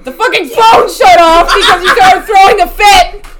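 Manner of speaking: loud laughing complaint; laughing shout. Real, not acted. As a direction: yell it while laughing. Phone died, yelling laughingly.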